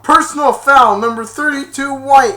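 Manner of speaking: high, loud official call; referee call. Retold or imitated. Referee calling the foul on Spike, loud official call. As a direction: announce the penalty.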